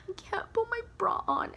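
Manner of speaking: mock whimper, high and breathy; whiny high whisper. Retelling herself failing with her bra, high whimpering whisper. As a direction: imitate her drunk, helpless self in a high whisper. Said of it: "so emotional".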